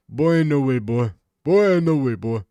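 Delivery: loud, high mock shout of boy ain't no way; mock catchphrase yell. Streamer mocking a catchphrase, loud and high. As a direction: belt it out like a hyped catchphrase.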